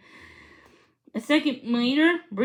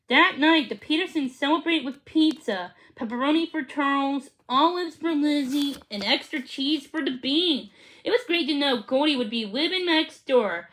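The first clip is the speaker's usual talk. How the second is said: ordinary delivery, nothing marked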